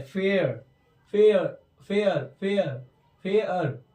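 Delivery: drawn-out "फेयर" with a blended ae vowel; teacher modelling "फेयर" for students; pronunciation demo. Shown as a demonstration. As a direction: say "फेयर" slowly, vowel between e and aa. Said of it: "ए और आ का mix sound है".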